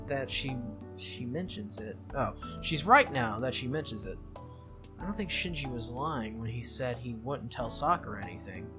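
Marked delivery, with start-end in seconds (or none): none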